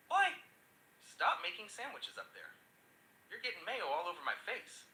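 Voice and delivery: mock yell up at the bunk; yelling brother. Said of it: "the brother bangs on the top bunk and yells".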